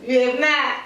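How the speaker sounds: ordinary delivery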